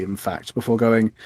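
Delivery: ordinary talk, nothing marked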